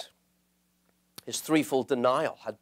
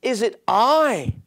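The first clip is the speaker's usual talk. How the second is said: much higher and louder, slow on is it I; anguished plea, high and loud; cry out; 11 semitones higher, 6 dB louder, much slower than usual. Acted. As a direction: cry it up to the Lord. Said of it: "should be to cry out".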